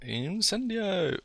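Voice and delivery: high, long-drawn spell chant; playful drawn-out incantation; mock spell cry. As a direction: stretch the spell out high and slow. Echoes the game's spell cry.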